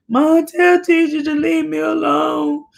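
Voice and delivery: high-pitched calling whine to mom; whining child. Retold or imitated.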